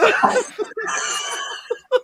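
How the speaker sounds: a high shrieking burst of laughter; high laughing shriek. Real, not acted. Remembering the manager on camera, shrieking with laughter. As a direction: let out a squealing howl of laughter. Howling at the memory.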